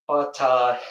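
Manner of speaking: normal talking voice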